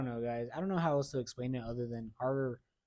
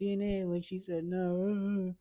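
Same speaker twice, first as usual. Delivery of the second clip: mock wailing; 6 semitones higher, slower than usual. Retold sobbing to mom.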